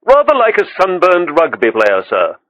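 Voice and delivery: polite high waiter voice; a deferential waiter offering a gift. A narrator's reading. Waiter describing the man.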